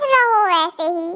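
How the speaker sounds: usual speaking voice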